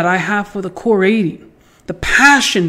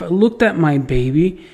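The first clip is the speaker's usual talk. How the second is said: ordinary talk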